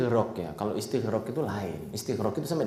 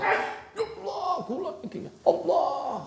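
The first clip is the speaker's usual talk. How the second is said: a much higher, drawn-out, theatrical plea; forced showy prayer; 8 semitones higher than usual. Retold or imitated. Preacher mocking a worshipper's forced, showy prayer, high and whiny. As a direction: ham up a pious worshipper forcing emotion.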